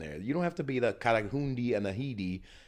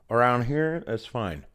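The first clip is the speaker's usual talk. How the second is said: ordinary talk, nothing marked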